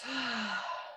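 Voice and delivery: long, airy sigh through the mouth; sighing exhale. Shown as a demonstration. Yoga teacher leads the class's breathing, sighing out. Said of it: "and sigh out through the mouth".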